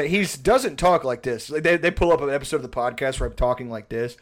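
usual speaking voice

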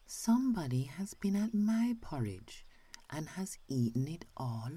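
put-on high, small baby bear voice; little wee bear voice. A narrator's reading. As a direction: squeak it out like the tiny bear. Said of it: "in his little small wee voice".

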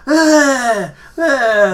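loud, high wordless mock yell; drawn-out, high-pitched angry "ah"; imitated angry yelling. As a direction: imitate an angry man yelling without words. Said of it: "he was all pissed off".

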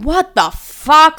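retold "you cannot be serious" reaction; appalled disbelief. Retold shocked reaction to invite.

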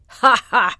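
big loud jolly ha ha; booming hearty laugh. Acted. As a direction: laugh like a jolly round sun. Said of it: "laughed the jolly round sun".

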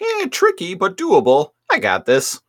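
squeaky sly sidekick voice; Rat Trap voice. Retold or imitated. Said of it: "And Rat Trap's like".

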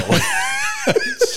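sudden high-pitched laughing shriek; high shriek of laughter. Real, not acted. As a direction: burst out in a shrill squeal of laughter. Reacts to Danny DeVito pick.

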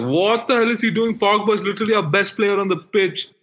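high-pitched, exasperated outburst; pissed off. Retold or imitated. Fan reliving Fred coming on for Pogba, pissed off. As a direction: protest the decision in indignant disbelief. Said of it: "I was so pissed".

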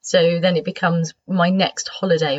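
ordinary delivery

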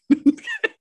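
shrieking high laugh; high squeal laugh. Real, not acted. Laugh alone.

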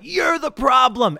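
drawn-out mock yell, pitched high; retold scream. Protester accusing a random stranger, mock screaming. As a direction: holler it slowly like an angry protester. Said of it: "starts screaming at him".

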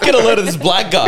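put-on high, laughing kid voice; imitating a kid. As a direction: play the amused kid, giggling. Imagines the kids' reaction, laughing.

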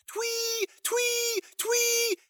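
very high shrill whistle sounds; whistle imitation. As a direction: mimic a tin whistle piercingly. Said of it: "blew it as hard as he could".

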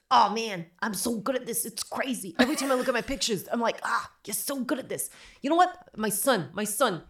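high, loud, nonstop chatter; chatty mammogram tech. Retold or imitated. Retelling the mammogram tech's endless bragging, high loud impression. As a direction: rattle on like a proud tech who never stops talking. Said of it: "this is her".

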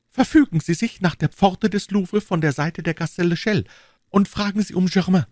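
put-on high young lady giving instructions; brief your helper in a high female voice; high-pitched, purposeful lady; Madame Bonacieux. A narrator's reading.

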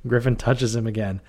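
ordinary talk, nothing marked

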